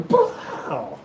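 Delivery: high, elongated imitated slap sound; exaggerated "pow" effect; drawn-out smack noise. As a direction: voice a cartoon impact.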